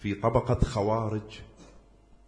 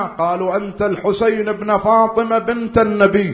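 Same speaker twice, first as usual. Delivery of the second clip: preaching at full force; 7 semitones higher, 6 dB louder than usual. Acted. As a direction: declaim it like a battlefield address. Preacher acts out Hussain's dialogue.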